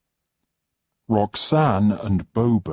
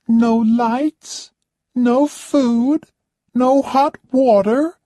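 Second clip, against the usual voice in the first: much higher and louder, a demanding whine; haughty high-pitched complaining lady; spoilt rich guest; 8 dB louder than usual. Acted. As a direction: play the pampered diva who expects service. Roxanne complains.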